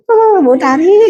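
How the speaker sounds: high, drawn-out spooky hum and name; wrong smiling voice. Shown as a demonstration. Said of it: "Apakah seperti ini?". (suara tinggi mendayu seram, dibuat-buat)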